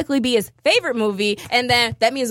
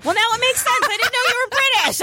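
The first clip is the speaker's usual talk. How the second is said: mock surprise; 12 semitones higher, faster than usual. Mock apology to friend.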